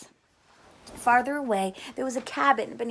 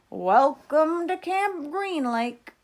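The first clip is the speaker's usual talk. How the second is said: slow, higher, louder drawn-out welcome; driver's voice; 6 semitones higher, 7 dB louder, much slower than usual. A narrator's reading. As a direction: stretch each word like an ironic bus driver. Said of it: "said the driver".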